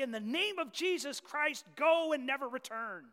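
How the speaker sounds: high, loud, forceful command; commanding rebuke. Retold or imitated. Believer driving sin out the window, commanding rebuke. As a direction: cast it out with full authority. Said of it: "and we say".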